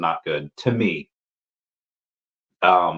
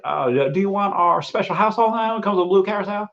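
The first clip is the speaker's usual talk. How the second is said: high Southern Belle sales pitch; put-on Southern Belle waitress, pitched way up; Southern Belle accent; 12 semitones higher than usual. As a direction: imitate a Southern Belle upselling a drink. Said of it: "Southern Belle accent".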